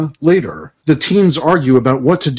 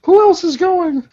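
put-on high girl voice. Voicing teen character.

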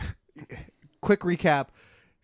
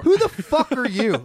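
mock outrage; 6 semitones higher, 6 dB louder than usual.

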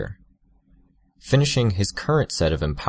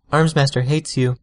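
Tattletale voice; 8 semitones higher, 3 dB louder than usual. A narrator's reading. Tattletale voice, raised.

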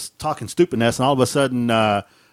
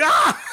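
startled yelp. Retold or imitated.

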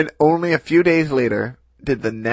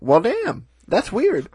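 surprised high voice; 9 semitones higher, slower than usual. Retold or imitated. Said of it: "he was like".

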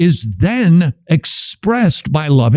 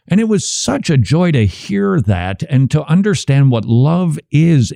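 normal talking voice

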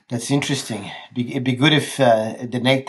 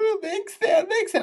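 very high mock blubbering; crying; 3 dB quieter than usual. Drunk passenger sobbing her suburb to cabbie, crying. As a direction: blubber it in a high, garbled wail. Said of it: "She was crying, and she was going".

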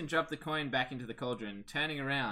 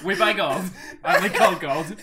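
infomercial voice; 6 semitones higher, 14 dB louder than usual. Pretend. Mock ad pitch, laughing.

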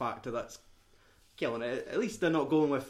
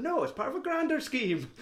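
high-pitched, emphatic protest; mock insistence; 12 semitones higher than usual. Retelling a con film to friends, high mock insistence. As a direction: insist on it like a deluded believer. Acting out character insisting it's planned.

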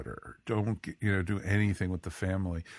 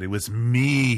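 gloating villain confession, louder; hammed-up evil villain boast; mustache twirling villain; 4 semitones higher, 7 dB louder, slower than usual. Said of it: "he was the mustache twirling villain".

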